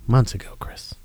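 whispered, teasing; whispered jab. Real, not acted. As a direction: whisper it pointedly. Pressing Chris about the poltergeist.

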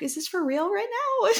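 high, laughing incredulity; high-pitched amused disbelief; retold disbelief. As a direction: squeal it in disbelief, breaking into laughter. Retold disbelief at daughter's excuses.

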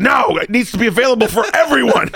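high, loud, laughing protest; comic high-pitched indignant shout; retold yelling. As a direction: yell it in mock outrage. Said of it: "I'm, like, yelling at him on text".

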